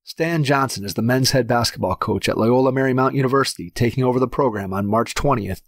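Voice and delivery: steady, even announcer read of the bio; podcast intro read. Acted. Host introduces the guest to listeners, in a steady announcer read. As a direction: read it like a radio host setting up the show.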